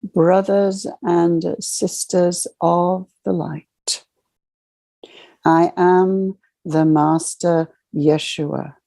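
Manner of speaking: ordinary talk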